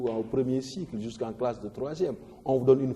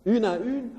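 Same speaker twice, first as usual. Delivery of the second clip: "une à une", high and loud, landing on the last "une"; accent on final UNE; 10 semitones higher, 5 dB louder than usual. Shown as a demonstration. Teacher marking the last accent, raised stress on the end of "une à une". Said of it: "U, tu mets un accent".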